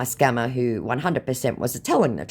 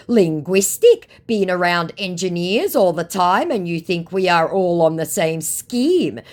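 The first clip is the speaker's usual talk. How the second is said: loud, higher imitation of a complaint; mock indignation; 6 semitones higher, 6 dB louder than usual. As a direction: put on an emphatic scammer protest. Acts out scammer's garbled reply.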